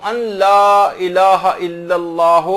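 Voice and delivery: ordinary talk, nothing marked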